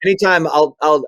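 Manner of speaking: usual speaking voice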